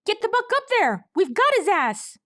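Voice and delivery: high, loud battle cry; rally the gang up high; high rallying shout. A narrator's reading. Colt rallies others.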